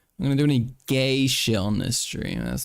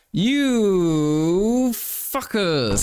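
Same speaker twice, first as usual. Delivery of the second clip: drawn-out, very slow mock fury, a touch higher; mock outrage; 7 semitones higher, much slower than usual. Streamer hams up fury at his teasing chat, very slowly. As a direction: stretch every syllable in hammed-up outrage. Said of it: "I am angry".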